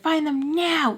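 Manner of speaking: high-pitched, drawn-out mock demand; super mad friend. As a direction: imitate an angry friend giving orders. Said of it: "she's, like, super mad about her towels".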